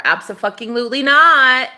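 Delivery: emphatic shouted no; loud refusal. Retold or imitated. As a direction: bark the final no at him. Throwing the intruder out.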